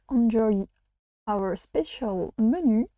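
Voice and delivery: slow, careful English title in a French accent; title read slowly in a French accent. Real, not acted. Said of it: "vraiment désolée pour l'accent anglais".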